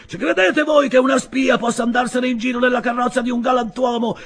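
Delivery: high, shouted outrage; loud indignant public accusation; haranguing the crowd. A narrator's reading. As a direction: rouse onlookers with an angry tirade. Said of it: "rivolgendosi alla folla".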